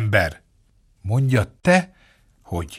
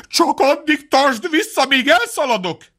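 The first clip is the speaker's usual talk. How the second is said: much higher, hurried frightened plea; beg, then bolt; scared fox; 12 semitones higher than usual. A narrator's reading. Frightened fox shouting in before running away, high and hurried.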